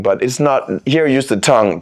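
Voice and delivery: ordinary talk, nothing marked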